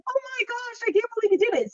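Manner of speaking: breathless high-pitched joy at a gift; thrilled disbelief. Retold or imitated. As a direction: gush like you just got the perfect gift. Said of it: "I was like".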